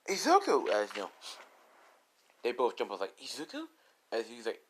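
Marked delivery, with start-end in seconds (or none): 0.1-0.7 loud, high exclamation; excited high shout of welcome; surprised greeting. Acted. 3.2-3.7 soft, high, rising query; hushed high-pitched questioning surprise; surprised question. Acted. Both jump up questioning.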